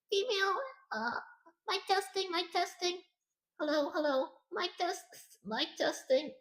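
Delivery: very high, soft put-on female voice. Shown as a demonstration. Streamer proving he can talk female, soft high voice. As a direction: lift it high and light, like a woman. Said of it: "I can talk in a female voice".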